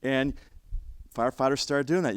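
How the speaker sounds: usual speaking voice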